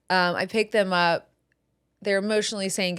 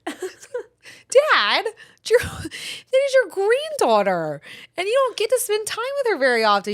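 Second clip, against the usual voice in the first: high, urgent protest to dad, pitched way up; pleading with dad, voice pitched up and insistent; exasperated high pleading; 14 semitones higher, 4 dB louder than usual. Retold or imitated. Daughter scolding her dad, high and exasperated.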